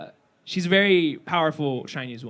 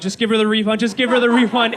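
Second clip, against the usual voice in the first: insistent boss; 5 semitones higher, 6 dB louder than usual. Retold or imitated. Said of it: "the store executive on the phone goes".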